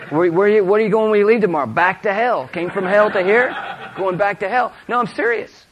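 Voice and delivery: high, loud, driving sermon delivery; fiery preaching. Real, not acted. Preacher challenges leaders going back to hell, fiery. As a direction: build to a pulpit shout.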